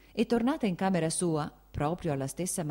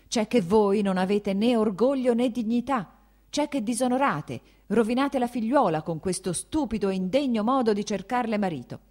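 loud, higher, scolding rant; angry shouting; 6 semitones higher, 6 dB louder than usual. Acted. The prince scolding his wife over Kitty, angry shout. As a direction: tear into her without pause.

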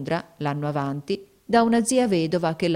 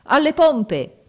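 loud, high barked order; captain roars an order over the storm; thundering shout; 9 semitones higher, 8 dB louder, much slower than usual. Acted. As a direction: bellow the command to the crew. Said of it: "Una voce tonante gridò".

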